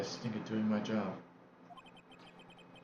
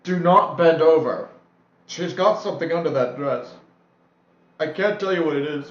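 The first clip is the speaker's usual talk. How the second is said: shouted warning; 5 semitones higher, 13 dB louder than usual. Acted. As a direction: yell it out like a frantic warning.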